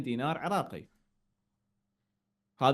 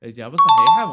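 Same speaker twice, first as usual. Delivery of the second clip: booming prize announcer; 5 semitones lower, 13 dB louder than usual. Acted. Quiz host announces right answer.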